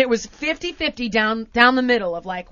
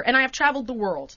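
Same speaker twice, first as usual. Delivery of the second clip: ordinary delivery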